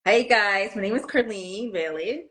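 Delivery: ordinary delivery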